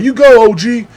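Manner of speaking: loud, high egging-on; mock cheer. Crowd cheering on the inmate.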